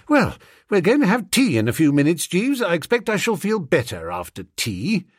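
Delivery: rattled gentleman. Acted. Master telling his valet tea will help, rattled. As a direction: play a flustered master hoping for relief.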